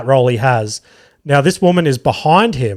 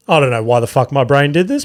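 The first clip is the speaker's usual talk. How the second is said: ordinary talk, nothing marked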